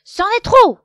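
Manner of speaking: very loud, high furious howl. A narrator's reading. Loup losing patience, furious shout. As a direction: explode with rage. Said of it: "hurle Loup".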